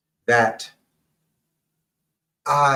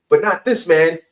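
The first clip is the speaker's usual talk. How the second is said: higher, louder, a puffed-up manly declaration; tough cartoon cop; 6 semitones higher, 9 dB louder than usual. Retelling a cartoon cop's line to the host, hammed-up and louder. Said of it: "And then he says".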